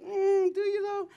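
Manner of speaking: high-pitched sceptical hum and question; mock-sweet, high-voiced doubt; gently doubting. Quoting a doubting reply.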